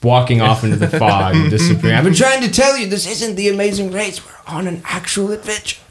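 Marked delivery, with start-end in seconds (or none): 1.9-5.8 high, fading softer as he leaves; pitched up, trailing off into the distance; elf's voice fading into the fog. Acted. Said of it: "He says".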